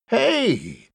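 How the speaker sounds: high-pitched sudden call. A narrator's reading. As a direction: call out to get attention. Calling out toward the house.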